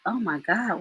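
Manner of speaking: ordinary talk, nothing marked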